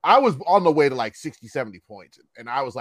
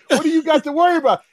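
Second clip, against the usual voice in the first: fast, high, loud mocking echo; angrier father; 11 semitones higher, 5 dB louder, faster than usual. Angry father quoting the boy back, acted outrage. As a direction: parrot the excuse back in outrage. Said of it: "he got angrier".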